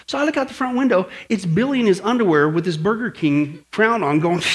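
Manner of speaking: ordinary delivery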